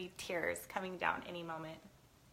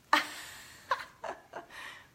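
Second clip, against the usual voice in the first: high, helpless laugh; teary laughing fit; 9 semitones higher than usual. Real, not acted. Cook totally crying over onions, helpless laughing. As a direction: burst out laughing at your own tears.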